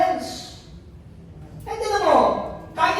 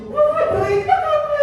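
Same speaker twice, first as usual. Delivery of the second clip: mock heartbroken wail; 12 semitones higher, 5 dB louder, slower than usual. Mock rich person crying over breakup.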